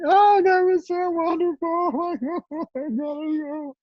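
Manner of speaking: very high, slow, gushing sigh of nostalgia; fond mock gush. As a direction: swoon over the memory like a doting parent. Parent looking back fondly.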